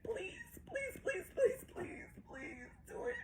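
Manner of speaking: very high, squeaked sobbing plea; real tearful pleading. Real, not acted. Mother begs daughter to do it, sobbing. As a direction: beg over and over, voice thinning into soft squeaks. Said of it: "I'm crying".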